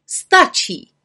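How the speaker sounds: high, loud call to halt. A narrator's reading. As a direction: call a sharp stop. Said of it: "zvolala vrchní čarodějnice".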